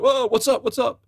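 high-pitched startled questioning; mock alarm. [name] reacts to Larry freaking out, high mock alarm. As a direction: imitate an alarmed friend.

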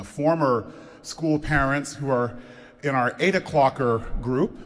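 ordinary talk

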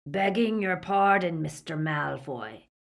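lightly voiced henchman cutting in; insolently polite interjection; Greyback. A narrator's reading. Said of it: "interjected Greyback".

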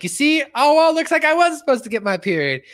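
high, loud cartoon voice; a high, loud Garrison impression; cartoon teacher voice. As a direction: announce it like a delighted cartoon teacher. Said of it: "stands like".

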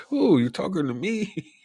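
high falsetto, playfully coy; mock coy woman. A man teasing scammers, high mock coy voice. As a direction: camp up a flattered woman's voice. Mocking flirty replies to scam texts.

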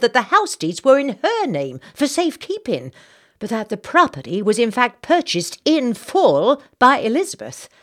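high, loud, indignant Southern lady; genteel outrage, fanning herself; Southern belle gossip. Acted. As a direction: play the scandalised society matron. Narrator voices Missus Bartlett, Southern lady.

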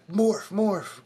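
high-pitched yell at normal volume; mock yell. Game master acts out a player yelling at Grant, mock yell. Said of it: "Yeah, you yell at him".

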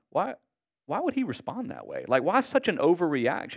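high-pitched mock puzzlement; baffled inner thought. Voicing a puzzled man's thoughts.

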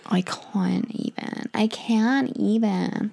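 slow American "I can't even", whispered then voiced; mock American exasperation, slowly; American accent. Shown as a demonstration. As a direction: drag out "I can't even" like an overwhelmed American teen. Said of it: "sorry about my American accent".

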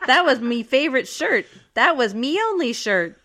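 laughing mock pirate accent; hammed-up pirate, laughing through. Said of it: "She's talking like a pirate now".

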